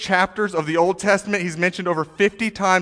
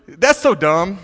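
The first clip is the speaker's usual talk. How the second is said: usual speaking voice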